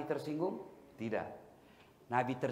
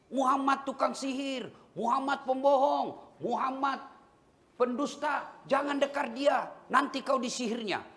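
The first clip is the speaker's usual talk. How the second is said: higher-pitched market crier, imitating jeers; mock shouting blind man; 11 semitones higher than usual. Preacher voicing a blind man yelling insults in the market, mock shouting. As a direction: act out a blind man's street taunts, higher up. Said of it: "dia teriak-teriak".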